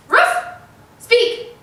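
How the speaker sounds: loud, high yap then command; high dog bark. Shown as a demonstration. Showing viewers the bark, high and loud. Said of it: "Until your dog understands".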